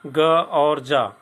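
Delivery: very slow ग then जा, each stretched; teacher sounding out ग and जा; sounds ग and जा. Shown as a demonstration. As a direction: draw out ग and जा. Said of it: "seven number जी".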